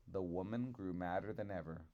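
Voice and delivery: plain reading voice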